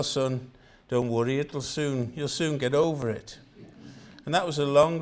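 normal talking voice

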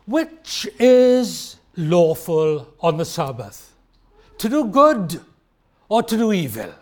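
slow, weighty delivery; slow rhetorical question. Retold or imitated. Jesus challenging people about the Sabbath, slow and weighty. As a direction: let each word land as a challenge. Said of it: "Jesus again asked people".

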